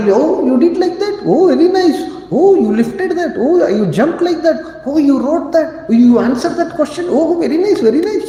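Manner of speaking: high, sing-song praising mother; mother cooing praise at her child; encouraging mother. Retold or imitated. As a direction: gush over the child's every little deed.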